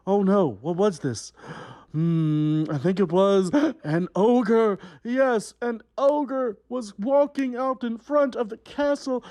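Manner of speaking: big, slow fairy-tale narration; hammed-up storyteller for a young audience; storytelling to children. A narrator's reading. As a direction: tell it like a melodramatic storybook. Reads fairy tale to kids.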